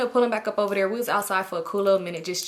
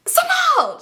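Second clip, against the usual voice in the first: high-pitched shrieked name; scream; 7 dB louder than usual. Retold or imitated. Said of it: "She screamed".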